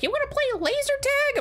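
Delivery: very high, nerdy squeak; squeaky mock invite. Mocks a laser tag player with a very high squeaky invite. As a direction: mimic an eager laser tag kid.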